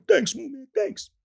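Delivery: very high, gushing imitation; high comic thank-you; starstruck fan. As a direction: squeal thanks like a giddy fan.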